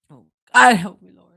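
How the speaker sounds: high, loud cry of Oh, God; real exasperated loud oh God; loud plea. Real, not acted. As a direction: throw it up to the sky in mock despair. Reacting to card number thirty.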